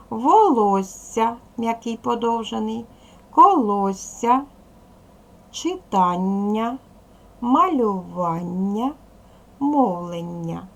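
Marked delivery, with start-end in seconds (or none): 0.1-1.4 slow, careful dictation reading. Shown as a demonstration. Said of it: "словниковий диктант". 3.3-10.7 slow, careful dictation reading. Shown as a demonstration. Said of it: "словниковий диктант".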